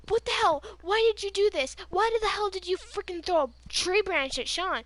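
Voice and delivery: high, loud, angry demand; retold yelling. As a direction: re-enact chewing out your ex. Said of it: "I start yelling so bad".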